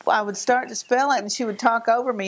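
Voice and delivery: normal talking voice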